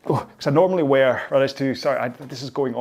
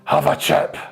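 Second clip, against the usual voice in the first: higher, louder broad Glasgow voice; aggressively friendly drunk; 6 semitones higher, 5 dB louder than usual. Retold or imitated. Glasgow drunk pressing chips on a passer-by, pushy Scottish accent. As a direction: push the chip on him, all friendly. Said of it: "aggressively friendly".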